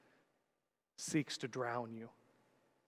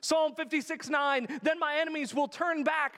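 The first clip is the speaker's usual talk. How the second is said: high, loud building sermon; impassioned preaching; 12 semitones higher, 6 dB louder than usual. Real, not acted. Pastor pressing the point to his congregation, loud and high. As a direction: preach it with rising urgency.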